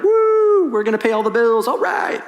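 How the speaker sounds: high, loud excited squeal from the wife; thrilled wife. Pretend. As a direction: cheer like a wife who just saw the bills paid. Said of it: "my wife's gonna be like".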